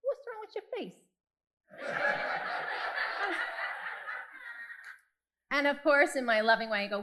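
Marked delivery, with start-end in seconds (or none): 0.0-0.9 put-on high, small child's voice; high little girl asking innocently; little daughter. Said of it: "she looks at me and she goes". 1.7-5.0 long high giggle; high giggling laugh. Real, not acted. Laughs at own story.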